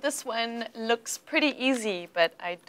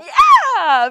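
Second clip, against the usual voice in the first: high, loud laughing yeah; excited cheer; 15 dB louder than usual. Real, not acted. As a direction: burst out with joy. Student answers stress right.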